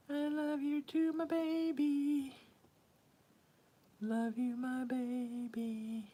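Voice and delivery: high, slow, tender cooing. Real, not acted. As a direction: stretch the words up high for the cat. Cooing to her cat.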